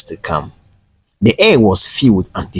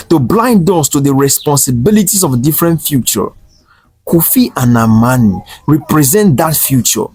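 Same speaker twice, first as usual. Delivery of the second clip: gentle but firm; 6 semitones higher, 3 dB louder than usual. A narrator's reading. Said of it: "his tone gentle but firm".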